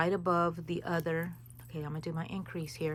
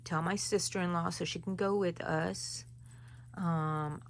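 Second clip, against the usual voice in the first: ordinary talk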